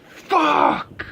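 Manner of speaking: high mock shout; Lars yelling. Lars right in James's face, mock shout. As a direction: blurt it like a brat losing it. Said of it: "just goes".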